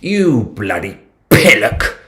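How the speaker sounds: slow, posh British voice, savouring each insult; mock posh British insult. British toff scolds imaginary friend, slow posh British accent. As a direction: play a British toff calling someone a wally, slowly. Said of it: "something on the menu called a battered wally".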